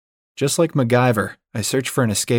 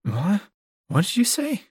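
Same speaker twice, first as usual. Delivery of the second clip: lightly voiced drowsy woman, pitched up; higher, half-awake questioning with a rising end; a touch of groggy confusion; mumbled sleepy question; 11 semitones higher than usual. A narrator's reading. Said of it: "She mumbles".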